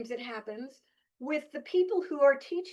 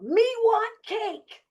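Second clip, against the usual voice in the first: put-on high, slow, childish shout; silly loud yell; 11 semitones higher, 5 dB louder, slower than usual. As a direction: yell it like a goofy kid demanding cake. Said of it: "I yelled really loud".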